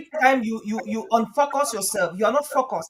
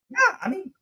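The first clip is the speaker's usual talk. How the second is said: usual speaking voice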